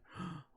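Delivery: a mock shocked gasp. People reacting to rough talk: mock shocked gasp. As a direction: gasp like the easily offended. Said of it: "everybody be like".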